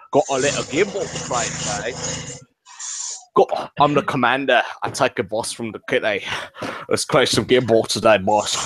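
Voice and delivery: a lightly put-on Scottish voice rallying the lads; Scottish coach impression. Coach rallying his lads before the game, in a lightly put-on Scottish voice. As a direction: gee up the team like a brawler boss.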